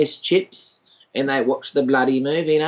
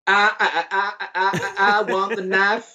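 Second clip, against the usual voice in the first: playful, higher-pitched stuttered film quote; film quote impression; 5 semitones higher than usual. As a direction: stammer the movie line in a higher voice. Quotes Golden Child film line.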